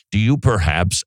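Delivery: ordinary delivery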